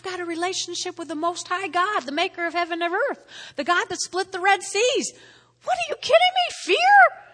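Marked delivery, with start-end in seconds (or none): 5.6-7.2 much higher, loud scoffing cry; incredulous shout. Real, not acted. Preacher to congregation, shouting in disbelief at fearing. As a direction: blast the idea of fear away.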